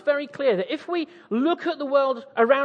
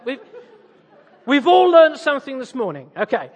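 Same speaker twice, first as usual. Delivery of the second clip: usual speaking voice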